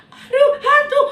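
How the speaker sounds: very high, loud scream with nervous laughter; terrified shriek. Acted. Disciples seeing a figure on the water, shrieking in fear. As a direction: shriek at the ghost in terror.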